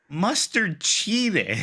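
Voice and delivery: high, drawn-out mock-tearful kid; imitating tearful child. Uncle retelling his nephew's protest at the race, mock tearful. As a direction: imitate a little boy wailing slowly through tears. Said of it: "with tears in his eye".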